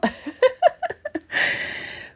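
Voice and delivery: airy, breathy laugh while catching breath; a real winded giggle; breathless laugh. Real, not acted. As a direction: laugh it off, still winded from the walk. Laughing after breathless dog walk.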